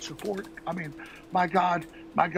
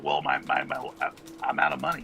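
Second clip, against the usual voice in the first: sheepish mumble. Retold or imitated. Imitating himself asking dad.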